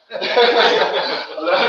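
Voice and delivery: big high burst of laughter; a long loud real laugh. Real, not acted. Laughing at his own story.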